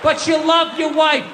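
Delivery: usual speaking voice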